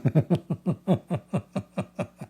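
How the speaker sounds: high breathy laugh. Real, not acted. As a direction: burst out laughing. Laughing at the Miracle sixty-nine joke.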